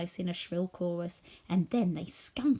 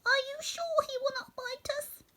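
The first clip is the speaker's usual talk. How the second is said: much higher, small and fearful; timid voice. A narrator's reading. Mouse queen peeking out from the grass, timid. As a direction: ask it nervously. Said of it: "asked in a timid voice".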